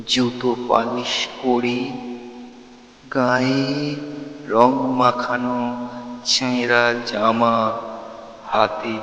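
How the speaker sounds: slow, measured verse with long pauses; declaimed poem, words drawn out; poem recitation. Acted. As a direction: recite dramatically, swelling on the lines and dropping on the refrain.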